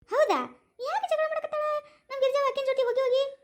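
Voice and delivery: put-on high-pitched village girl asking in shock; surprised role voice. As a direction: gasp out the why in disbelief. Young woman reacts to quarrel news.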